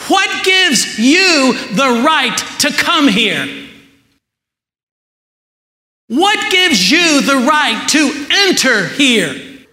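high, drawn-out demanding question; heaven's challenge. Acted. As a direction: challenge the newcomer at heaven's gate, slowly and high. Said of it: "heaven is asking this question".

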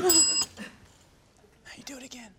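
soft unvoiced aside, slow; whisper. Real, not acted. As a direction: whisper the cue under your breath.

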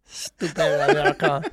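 slow, laughing put-on French sneer; slow mock French sneer. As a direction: sneer like a snooty French waiter. Voicing French waiter.

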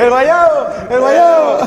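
loud, high excited call; loud excited announcement. Real, not acted. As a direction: shout it out to everyone. Calling out food.

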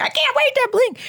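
very high, sing-out fake excitement; mock eagerness. Patient mocks waking up keen for bling, fake-excited. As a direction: squeal it as a sarcastic pretend wish.

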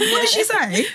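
a high, laughing what-did-she-say; high amazed squeal. Real, not acted. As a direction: squeal it in disbelief. Laughing disbelief at friend.

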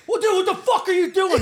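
high, loud outraged yell with laughter under it; startled shout at a sleepwalking intruder; retold screaming. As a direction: yell it in mock outrage, pitch jumping up. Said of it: "So I start screaming at him".

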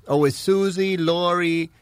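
ordinary talk

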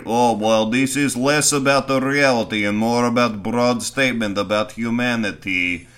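loud, hammed-up Serbian accent; put-on Serbian accent, projected; mock Serbian director. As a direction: play a Serbian auteur, pronouncing grandly. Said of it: "the Serbian director is, like, Serbian Kubrick".

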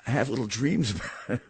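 breaking into a small real laugh; amused chuckle. Real, not acted. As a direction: chuckle as the words finish. Chuckles at own spy-movie joke.

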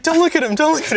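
high, loud mock hiss of a plea; acted plea. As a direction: squeak it out urgently, high and loud. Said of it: "It's like".

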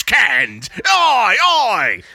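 yelled punk chant, high and loud; punk shout. Pretend. Mocking a punk band, yelled oi oi chant.

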